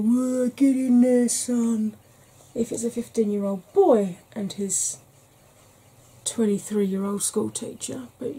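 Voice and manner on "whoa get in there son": slow, louder blokey cheer of approval; laddish cheer. Retold or imitated. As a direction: egg him on like a lad at the pub. Mock cheer of lads' approval.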